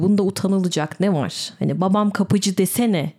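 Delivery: ordinary talk, nothing marked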